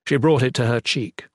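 ordinary delivery

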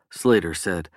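plain reading voice

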